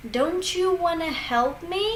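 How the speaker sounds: "Don't you" said as "donchu"; linked CH sound. Shown as a demonstration. Teacher giving another example, "Don't you" as "donchu". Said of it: "T becomes like a C H sound".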